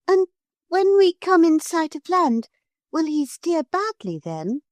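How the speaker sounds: put-on high, worried girl; a little girl wondering; Lucy's voice. A narrator's reading. Lucy asks the Lord High Islander how to find the way, high.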